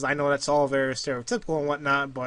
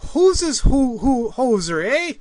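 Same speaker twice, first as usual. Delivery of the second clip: lightly hammed-up Canadian accent, higher; a mock Canadian judge asking "Who's this who, who, hoser, eh?"; 7 semitones higher, 5 dB louder, slower than usual. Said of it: "supposed to have a Canadian accent".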